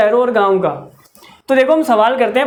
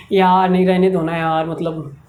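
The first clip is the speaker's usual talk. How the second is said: put-on bored dismissive girl; 6 semitones lower than usual. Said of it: "लड़की उसे".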